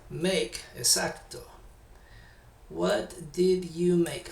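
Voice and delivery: ordinary delivery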